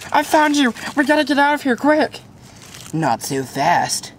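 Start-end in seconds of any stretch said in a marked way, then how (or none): 2.9-4.1 villain voice. Acted. Villain appears.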